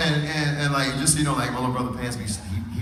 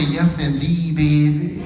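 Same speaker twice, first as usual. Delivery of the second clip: imitating mama's drawn-out catchphrase; New Orleans mama; 4 dB louder, much slower than usual. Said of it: "My mama say".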